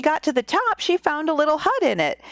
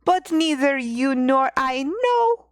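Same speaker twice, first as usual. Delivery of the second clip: slow, higher hammed-up Russian accent; terrible Russian accent; 5 semitones higher, slower than usual. Old woman wondering about the pea, in a mock Russian accent. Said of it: "insert my terrible Russian accent".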